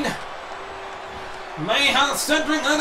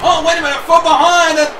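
ordinary talk, nothing marked

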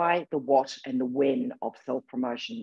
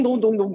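mock call-leaving dongs; 9 semitones higher, 5 dB louder than usual. Mimicking people leaving the call.

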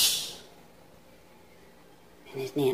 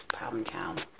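soft whispered aside; a dry hushed aside; 9 semitones lower, 13 dB quieter than usual. Real, not acted. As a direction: whisper it so the bird won't hear. Aside about the parrot.

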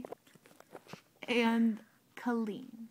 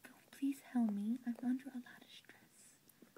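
whispering; 8 dB quieter than usual. Acted. Whispering stressed.

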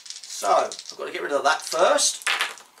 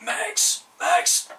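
overacted death scene; 11 semitones higher than usual. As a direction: ham up a dying hero's plea. Dying driver calls to Max.

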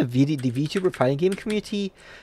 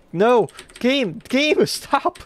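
laughing yell; 10 semitones higher, much slower than usual. Real, not acted. Streamer yells at the game as it betrays him, laughing shout. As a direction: scream at the game half laughing.

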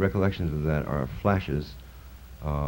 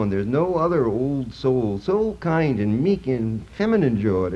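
high, loud call to the angels; declaimed lament; 7 semitones higher, 4 dB louder than usual. Acted. Poet summoning the angels to mourn the dead poet, loud and high. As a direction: cry it out like a grieving invocation.

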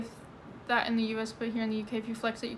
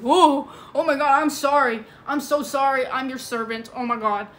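loud hammed-up panicked apology; mock groveling; 2 semitones higher, 8 dB louder than usual. Mock groveling apology to someone flexing a gun. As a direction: grovel in mock terror, overdone. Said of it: "what do they expect me to do".

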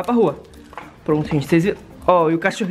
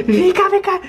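high, loud call to come here; calling the dog; 9 semitones higher, 4 dB louder than usual. Real, not acted. Owner calling the dog across the yard, loud high call. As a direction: call out sharply, higher and louder.

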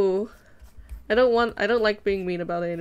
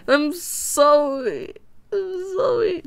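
whiny apology; 7 semitones higher, 3 dB louder, much slower than usual. Pretend. After being told to stop cussing.

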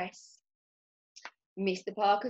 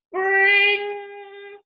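long, high, loud imitated ring; insistent doorbell; 12 semitones higher, 7 dB louder than usual. A narrator's reading. Mister Parker leans on the buzzer, long insistent ring. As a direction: hold the bell sound out insistently.

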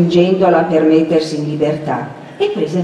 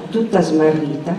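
plain reading voice

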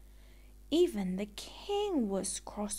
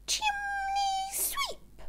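high breathy sneeze on the words; sneezed words; slower than usual. Acted. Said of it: "When she sneezed, it sounded as if".

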